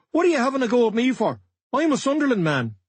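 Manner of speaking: high, pleading outrage; indignant protest. Retold or imitated. Dillon protesting to the manager mid-scuffle, high and indignant. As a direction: protest your innocence, voice up high.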